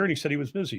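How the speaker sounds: ordinary delivery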